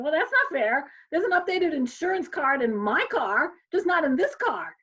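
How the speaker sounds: high, emphatic "that's not fair" complaint; indignant protest. Retold or imitated. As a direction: argue your case, pitch swinging up high. Retold protest to the cop.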